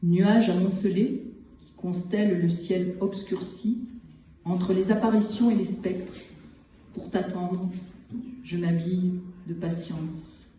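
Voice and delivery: measured poem recital with long pauses between phrases; poetry reading. Acted. Poet reads short poems from the collection to listeners, measured recital. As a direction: set each short phrase apart, letting silence follow.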